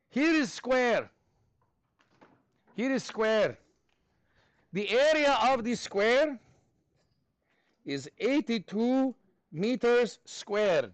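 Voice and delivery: slow, deliberate mock Russian accent. Teacher setting a square problem, slow mock Russian accent. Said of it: "do this in a Russian accent".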